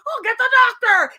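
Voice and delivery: high, loud mock panic; urgent cry. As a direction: call for help in feigned alarm. Acting Vision calling for help.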